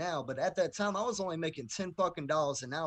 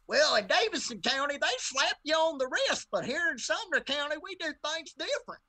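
a much higher-pitched, louder imitation of a small-town judge; mock stern judge; 11 semitones higher, 4 dB louder than usual. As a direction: ham up the judge lecturing him, higher and louder. Mocking the judge's reply.

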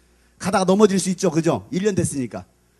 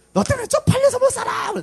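very high-pitched mother's complaint; mock embarrassed scolding; 3 dB louder than usual. Mother dragging her child away, high mock scolding. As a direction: wail in hammed-up exasperation at the child.

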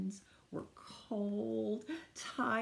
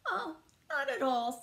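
higher, louder rabbit voice; breezy reassurance; 7 semitones higher, 5 dB louder than usual. Acted. Rabbit answers Tortoise's worry about space, breezily. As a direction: lightly wave the worry away, pitched up.

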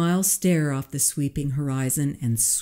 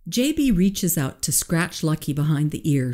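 ordinary delivery, nothing marked

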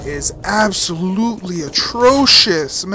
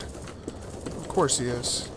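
slow, breathy sarcastic aside; muttered sarcasm at the hiding enemy; 5 semitones lower, 11 dB quieter, much slower than usual. Real, not acted. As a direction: drawl it out, fed up. Enemy hides behind him.

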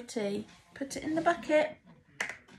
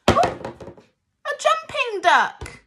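much higher, loud, slow delight; excited; 12 semitones higher, 8 dB louder, slower than usual. Acted. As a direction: marvel loudly at the toy. Toy duck jumps.

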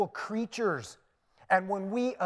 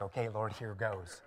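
muttered prayer aside; 9 semitones lower, 9 dB quieter than usual. Retold or imitated. Private aside to God before answering.

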